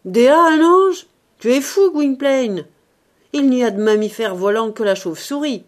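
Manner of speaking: loud, high, scoffing retort; Ursus. A narrator's reading. Ursus answering his own imitation, loud scoffing. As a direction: answer back in blustering disbelief. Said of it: "il répliqua avec la voix d'Ursus".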